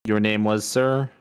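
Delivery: rising questioning tone on "sir". Acted. Asking a stranger his name, questioning. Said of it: "Like, questioning".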